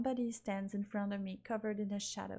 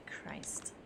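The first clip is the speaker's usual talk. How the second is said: breathless whispered Christ; whispered curse; 9 dB quieter than usual. Acted. Stunned player whispering to herself, breathless curse. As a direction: mutter it under your breath, stunned.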